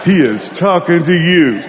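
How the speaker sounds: slow, deliberate mimicked accusation; single him out slowly, word by word; mock pointed accusation. Congregation turns to the sinner, mock accusing.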